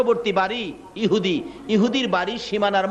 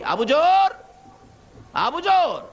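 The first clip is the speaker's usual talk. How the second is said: high, drawn-out call of a name; 10 semitones higher, 3 dB louder, much slower than usual. Acted. The Prophet calls Abu Zar at his house, calling out high. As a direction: call to someone inside the house.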